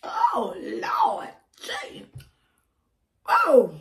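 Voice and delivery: ordinary delivery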